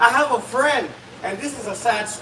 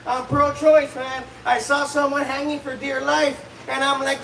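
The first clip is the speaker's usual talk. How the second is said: ordinary delivery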